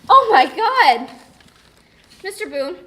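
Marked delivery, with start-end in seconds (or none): 0.1-1.1 loud, high, drawn-out exclamation; alarmed outcry. Acted. Teenager opening a confession about trouble, alarmed loud outcry. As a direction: cry it out slowly at full volume. Acted monologue opens with outcry.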